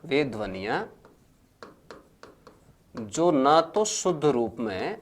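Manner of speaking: slow, spaced dictation with phrases repeated; dictating notes to a class at writing pace. Acted. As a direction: read it out phrase by phrase for students to copy. Teacher dictating a definition.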